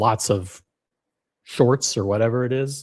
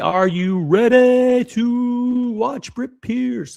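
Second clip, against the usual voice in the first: much higher and slowed right down, like a ring announcer; hype announcer; 12 semitones higher, 3 dB louder, much slower than usual. Real, not acted. Streamer hyping chat before the video, high drawn-out announcer call. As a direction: sell it to the crowd, stretched and high.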